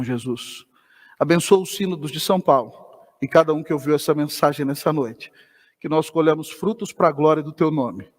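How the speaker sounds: low, subdued prayer voice; closing prayer. Real, not acted. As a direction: bring it down into reverent, measured prayer. (oração final em voz grave e contida)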